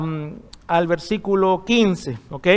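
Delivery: usual speaking voice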